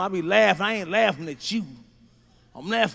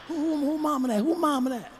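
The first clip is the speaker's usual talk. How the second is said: hushed, high-pitched imitation of whispering kids; mock classroom whisper; 5 semitones higher, 6 dB quieter than usual. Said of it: "everybody in the class be whispering".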